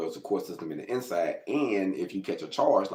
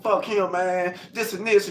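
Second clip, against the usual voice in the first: high, raised angry yell; 11 semitones higher, 3 dB louder, slower than usual. Retold or imitated. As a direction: let the rage out at the guy you stabbed. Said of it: "I'm screaming like".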